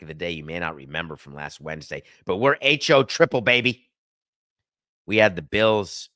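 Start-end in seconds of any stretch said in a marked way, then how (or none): 2.3-3.9 excited brag. Real, not acted. Celebrating a winning pick.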